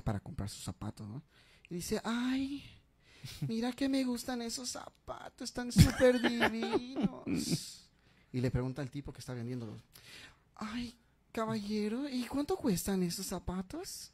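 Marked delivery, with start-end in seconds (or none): 2.0-7.9 higher, gushing voice, slowing on divinos; effeminate customer. Retold or imitated. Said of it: "medias afeminadas". 10.6-14.1 higher, slow coy whisper; effeminate customer whisper. Retold or imitated. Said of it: "medias afeminadas".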